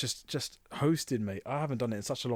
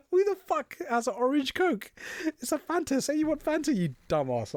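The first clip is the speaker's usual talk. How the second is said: high, laughing rant; high mock tirade about Fanta; amused rant. Real, not acted.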